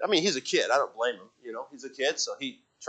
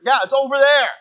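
mock defensive; 11 semitones higher, 6 dB louder than usual. Retelling argument with wife.

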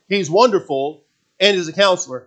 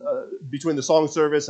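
ordinary delivery

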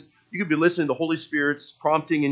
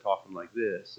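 low, soft, tired delivery; dry and boring; 6 semitones lower, 11 dB quieter than usual. Shown as a demonstration. Actor tired of his character voice, dry and boring. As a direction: let the character voice sag into dull, tired talk. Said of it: "it just got dry and boring".

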